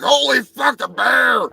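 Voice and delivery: the man jumps at spotting the bear; startled man shout. Retold or imitated.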